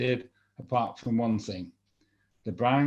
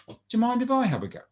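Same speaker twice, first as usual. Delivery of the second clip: wife's voice; 12 semitones higher, faster than usual. Acted. Wife asks king to fish.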